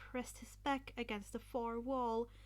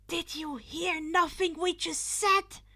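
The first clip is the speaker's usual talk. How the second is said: loud, higher, outraged; exasperated shout; 8 semitones higher, 11 dB louder than usual. Acted. Ida shouting at Izuku for ignoring them. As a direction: yell in disbelief.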